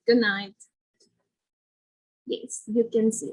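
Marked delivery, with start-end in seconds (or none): 0.0-0.5 "good night" said as gun-night, as to mom; casual, linked "good night" with an N for the D; D becomes N. Shown as a demonstration. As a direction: drop the D of "good" into an N. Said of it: "will become an N".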